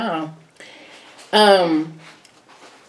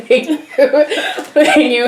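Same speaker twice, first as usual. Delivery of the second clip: laughing; 5 semitones higher, much slower than usual. Real, not acted. Laughing while struggling with chips.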